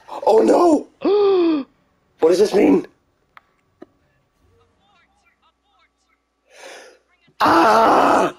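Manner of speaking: high gasping disbelief; shocked cry. Real, not acted. Character dies, shock.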